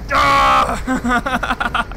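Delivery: high loud yelp of shock; startled shriek. Real, not acted. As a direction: cry out at the gunfire. Opening fire.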